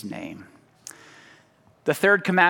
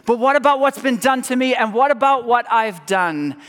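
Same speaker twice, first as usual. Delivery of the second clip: pleading objection; 8 semitones higher, 5 dB louder than usual. Pretend. Anguished objection voiced.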